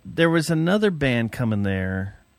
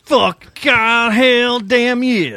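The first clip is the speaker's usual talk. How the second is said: hammed-up hype yell; 9 semitones higher, 7 dB louder, much slower than usual. Hammed-up [name] hype.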